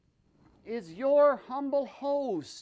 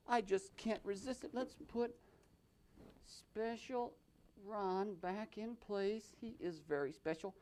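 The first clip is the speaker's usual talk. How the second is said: soft aside; 3 semitones lower, 14 dB quieter than usual. Acted. Doll-play aside, very soft.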